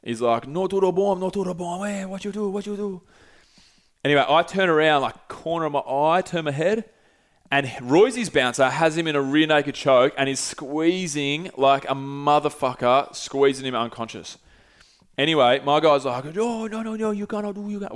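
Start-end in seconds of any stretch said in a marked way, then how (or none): 0.5-3.0 high, soft imitation of a Portuguese rant; held-back yell with a put-on Brazilian accent; mock Portuguese yelling. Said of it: "starts yelling in Portuguese". 16.4-17.9 high, softened imitation of a bouncer protesting; held-back mock-Brazilian protest; mock Portuguese bouncer. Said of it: "my bouncer starts yelling in Portuguese".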